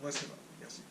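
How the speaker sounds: soft breathy whisper answering himself; whispered aside. Pretend. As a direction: murmur the reply to yourself. Answers own greeting in aside voice. (réponse chuchotée à soi-même)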